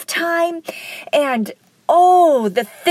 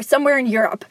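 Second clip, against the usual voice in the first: ordinary talk, nothing marked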